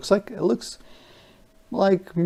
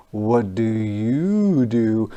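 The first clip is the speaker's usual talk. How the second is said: usual speaking voice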